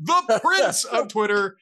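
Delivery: playful, drawn-out introduction; announcer flourish. Pretend. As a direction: stretch out the title like an emcee. Host's grand sign-off of guest.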